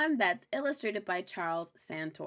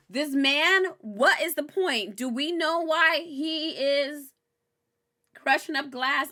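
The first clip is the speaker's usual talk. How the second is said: louder, higher, slow exasperated rant; baffled; 7 semitones higher, 5 dB louder, slower than usual. Real, not acted. Vlogger ranting at the show, loud baffled disbelief. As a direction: demand an answer, incredulous.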